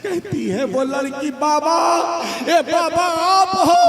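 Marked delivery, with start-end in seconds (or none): 1.3-3.8 rising call, louder and higher. Acted. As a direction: call out to the saint in surprise. Girl calls out to the saint. (ऊँची आवाज़ में पुकारना)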